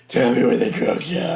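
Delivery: Batman voice. Retold or imitated. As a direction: deliver it gruff after clearing the throat. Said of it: "a lump in his throat".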